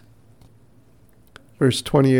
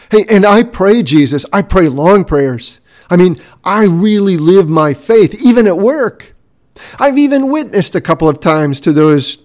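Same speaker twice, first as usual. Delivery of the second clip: boastful disciple; 2 semitones higher, 4 dB louder than usual. Pretend. Disciple bragging to Jesus about his faith, loud and cocky. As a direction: puff yourself up like a show-off believer. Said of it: "Jesus said as they walked along".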